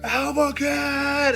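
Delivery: high, drawn-out awed oh; starstruck crowd. Retold or imitated. Team recognizing the creator, high and awed. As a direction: swoon over the name. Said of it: "And they all go".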